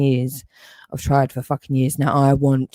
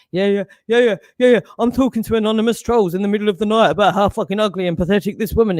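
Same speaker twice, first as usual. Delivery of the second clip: fast, higher sneering mimicry; mocking imitation; 5 semitones higher, faster than usual. Man parodies the troll's late-night posts, fast and sneering. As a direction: rattle it off as a scornful parody.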